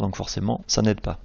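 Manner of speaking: ordinary talk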